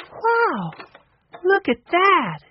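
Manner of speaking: put-on high, awed girl's voice; a girl marvelling at the box; amazed girl. A narrator's reading. Narrator voicing Violet, amazed.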